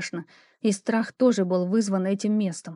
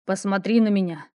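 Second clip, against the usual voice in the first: commanding. Acted. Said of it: "Металл в его голосе".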